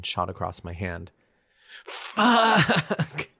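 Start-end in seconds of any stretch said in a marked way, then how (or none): 1.8-3.3 loud, high cry of pain. A narrator's reading. After punching the stone statue, pained cry. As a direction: yelp after smashing a fist. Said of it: "I cried".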